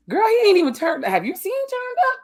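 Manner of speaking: exasperated shriek. Retold or imitated. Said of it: "and I'm like".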